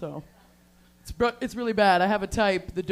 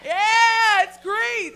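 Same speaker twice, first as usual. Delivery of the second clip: high, loud whoop into words; high-pitched elated shout; excited cheering; 11 semitones higher, 6 dB louder, much slower than usual. Real, not acted. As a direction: cheer along with the crowd. Comic cheers Pride crowd.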